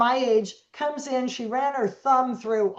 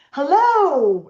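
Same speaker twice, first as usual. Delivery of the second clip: big bright sing-song hello, pitched up and loud; exaggerated cheery hello; 5 semitones higher, 7 dB louder than usual. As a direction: greet the boy like it's the best news all week. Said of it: "with some exaggerated communication".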